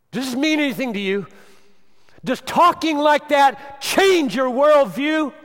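loud, high preaching at full force; thundering sermon voice; fiery preaching. Acted. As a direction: preach it hard to the congregation.

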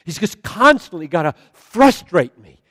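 very loud, high, impassioned complaint; anguished preaching. Acted. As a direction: hammer out each frustration at full force. Preacher at full force.

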